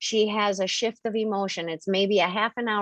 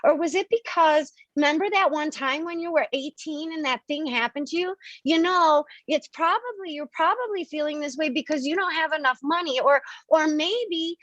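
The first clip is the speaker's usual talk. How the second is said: higher, rapid guessing inner voice; voicing the analytical self; 6 semitones higher than usual. The analytical self guesses at the emotional self's mood, high and searching. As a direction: fire off reasons, pitch climbing. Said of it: "And so the analytical self is like".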